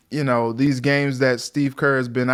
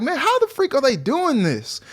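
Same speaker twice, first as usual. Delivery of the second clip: amazed disbelief; 11 semitones higher than usual. Retold or imitated. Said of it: "I was just like".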